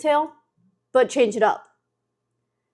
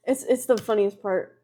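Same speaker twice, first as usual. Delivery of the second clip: ordinary talk, nothing marked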